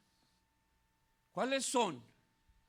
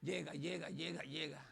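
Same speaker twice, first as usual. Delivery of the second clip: softer, lower, drowsy coaxing; lulling call; 6 semitones lower, 9 dB quieter than usual. Acted. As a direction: beckon sleep in softly. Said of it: "Usted le habla". (llamando al sueño en voz baja)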